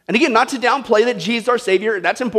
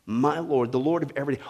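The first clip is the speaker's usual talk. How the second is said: mock prayer; 6 semitones lower, 5 dB quieter than usual. A hint of a believer's daily prayer to Jesus. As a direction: pray it gently and humbly. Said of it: "do we confess".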